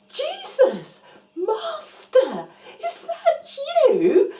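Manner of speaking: high, loud, laughing through tears; overjoyed, half laughing half crying; joyful tearful cry. Acted. Mary recognizing Jesus, high joyful tearful cry.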